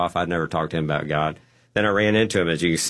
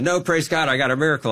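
excited; 8 semitones higher than usual. Retold or imitated. Joyful miracle claim to nurse.

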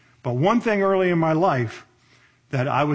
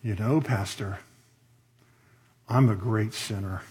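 lower, softer, pious and self-satisfied; mock-pious confession said lower and softer; very spiritual tone; 6 semitones lower, 4 dB quieter than usual. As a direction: play the humble saint, a bit smug. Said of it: "in a very spiritual tone".

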